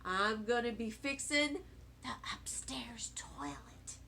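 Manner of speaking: fading into a whisper at the end. Acted. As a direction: let the last words drop to a breathy secret. Grandpa says plan quietly.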